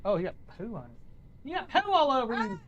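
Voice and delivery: high, soft cooing pet voice; sweet-talking a pet bird, softly. Real, not acted. Talking to his chicken.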